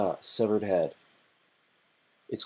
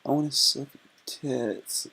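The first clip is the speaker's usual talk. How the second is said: put-on much higher, slow, sing-song girl voice; girly voice; 7 semitones higher, slower than usual. Streamer acting the girl insisting to the guy, high girly voice. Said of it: "And she goes".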